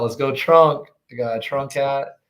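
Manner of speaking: usual speaking voice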